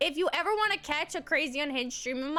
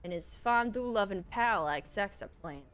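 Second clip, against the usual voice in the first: low narrator voice; 7 semitones lower, 5 dB quieter than usual. Acted. Reads game text in low voice.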